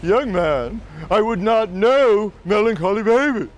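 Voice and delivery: high, proud diva voice; imperious mock soprano speech; haughty opera singer. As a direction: put the heckler down with cool disdain. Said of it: "with her coolest and proudest stare and says".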